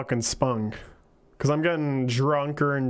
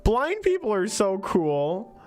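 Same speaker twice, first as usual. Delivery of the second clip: put-on high, slow, smiling little girl; child voice; 8 semitones higher, slower than usual. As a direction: say it like a delighted child. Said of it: "hear the smile in her voice".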